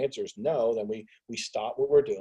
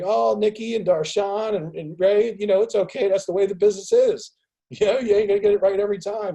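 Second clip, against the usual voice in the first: mock consoling voice; 10 semitones higher, 8 dB louder than usual. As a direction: coo like pitying friends. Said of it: "They're gonna be like".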